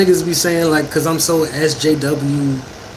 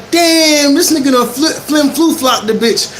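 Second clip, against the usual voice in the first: half-laughing, raised excited yell; excited exclamation; 8 semitones higher than usual. Retold or imitated. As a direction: blurt it out amazed, laughing. Friend exclaiming after the fight.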